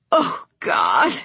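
choked sob on the words; crying. Acted. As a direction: break down crying. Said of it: "and began to cry again".

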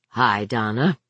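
low, guarded greeting; reserved, careful. A narrator's reading. Husband greeting his wife by phone, reserved and careful. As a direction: hold back, pitched well down. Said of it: "but so reserved, so careful".

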